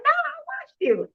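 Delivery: very high-pitched mock insistence; imitated excited agent. Customer service agent telling caller she watches her, imitated very high.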